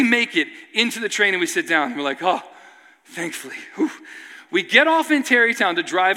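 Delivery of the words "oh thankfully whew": soft, low sigh of relief; mock exhausted relief, dropping away softly; acted relief. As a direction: let it out quietly, as if finally sitting down. Sinking into train seat, relieved.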